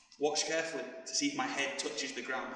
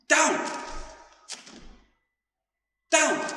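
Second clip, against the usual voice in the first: shouted commands; 9 semitones higher, 6 dB louder, slower than usual. Real, not acted. Coach calling drill directions.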